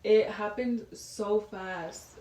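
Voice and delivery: ordinary talk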